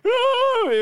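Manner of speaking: high drawn-out mock cry; imitated crying, one long high note; imitated child's wail. Daughter hiding her hurt eye, mock wailing. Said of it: "she's crying".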